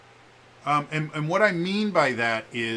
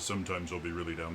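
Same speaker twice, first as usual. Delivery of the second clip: low pitch; 6 semitones lower, 8 dB quieter, faster than usual. Shown as a demonstration. Streamer showing viewers his expressive range, low pitch. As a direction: drop the voice down low. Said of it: "my pitch travels a lot".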